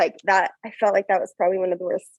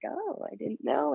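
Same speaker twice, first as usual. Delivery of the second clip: mock surprise; 7 semitones higher, 10 dB quieter, slower than usual. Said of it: "they're like".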